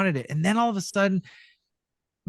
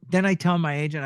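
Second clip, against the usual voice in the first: usual speaking voice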